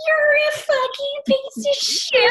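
put-on high, loud scream-crying in a Southern accent; hammed-up Southern shriek, slow and furious; angry Southern yelling. Re-enacting a fight with a boyfriend, scream-crying in a Southern accent. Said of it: "in a Southern accent. Like, imagine me".

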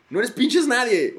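loud, high, slow rant; exasperated comic rant; ranting shout. Real, not acted. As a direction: yell it out at the UN, fed up.

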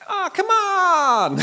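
high, loud, drawn-out protest; Andy from Cougar Town. Acted. Preacher quoting a sitcom character, exasperated wail. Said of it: "there's a guy in it called Andy who goes".

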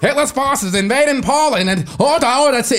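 high, loud laughing newsreel announcer; old radio newsreader. Retold or imitated. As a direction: ham up a crackly wartime broadcast. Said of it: "old radio casts".

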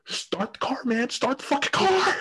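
high, strained mock yell; retold yell at boss. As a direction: yell at the boss to start the car. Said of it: "screaming at my boss".

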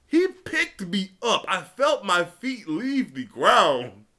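usual speaking voice

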